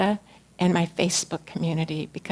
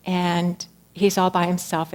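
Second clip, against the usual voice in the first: ordinary talk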